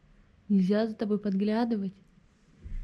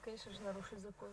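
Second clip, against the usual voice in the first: quick whisper; 20 dB quieter, much faster than usual. Real, not acted. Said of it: "моя жена такая бунтарка".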